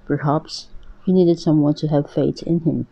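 plain reading voice

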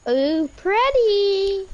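high, slow, gushing ooh; delighted squeal. Real, not acted. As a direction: gush over something pretty. Spots something shiny.

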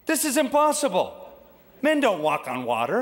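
high, startled realisation; sudden panic creeping in; alarmed. Acted. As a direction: Peter suddenly doubting, rising alarm. Peter's doubt rising.

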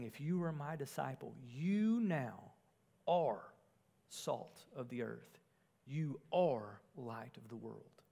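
are set apart between pauses, soft and slow; quiet, slow delivery with weight on are; stress on are. Shown as a demonstration. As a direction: linger on the word are, then pause. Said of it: "there stresses being rather than doing".

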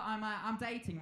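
ordinary talk

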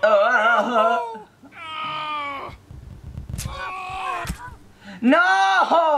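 real dismay, cry it out; anguished cry. Real, not acted. Failing in game.

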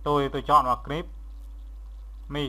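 ordinary delivery

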